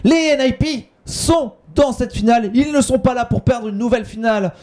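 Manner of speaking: high, hyped caster call; fighting game announcer. Real, not acted. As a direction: keep the hype ringing. Caster still hyped after round.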